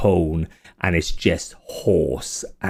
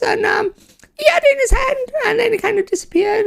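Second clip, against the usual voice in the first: very high wheezy little imp voice. Acted. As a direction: squeak and wheeze like a nervous imp. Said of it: "this wheezy voice that kinda comes in and out".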